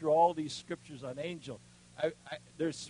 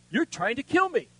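a sudden higher, louder cry at the attacker; retold shout; 10 semitones higher, 11 dB louder than usual. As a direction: shout it at the man you've pinned to the wall. Confronting attacker pinned to wall.

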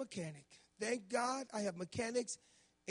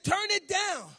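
high, loud order to turn it down; loud demand; 10 semitones higher, 10 dB louder, slower than usual. Retold or imitated. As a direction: snap it over the music. Wife demanding music lowered.

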